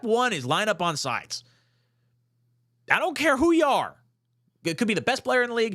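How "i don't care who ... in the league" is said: real frustration boiling over; fired-up rant. Real, not acted. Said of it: "Can you tell I'm fired up?".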